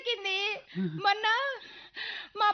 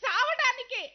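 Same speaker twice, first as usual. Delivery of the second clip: tearful answer. Acted. Wife crying.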